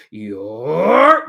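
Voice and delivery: drawn-out rising bear yell; shouting voice. Acted. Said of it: "this voice is very loud".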